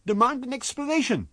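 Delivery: high, insistent landlady command; insist, high and sharp. Acted. Wife urges husband.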